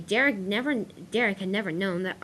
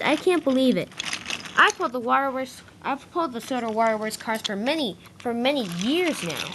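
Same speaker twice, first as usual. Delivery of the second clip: loud, higher-pitched storybook huff; mildly outraged complaint, voice lifted; indignant; 5 semitones higher, 4 dB louder than usual. A narrator's reading. Arthur complaining as he storms along the line, indignant. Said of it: "Arthur stormed along the line".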